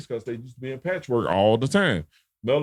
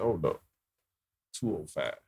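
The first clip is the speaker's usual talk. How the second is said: "Two oh five" dropped low and quiet like a correction; dismissive correction; 7 semitones lower, 8 dB quieter than usual. Retold or imitated. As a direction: lower and softer, brushing the answer off. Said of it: "He said".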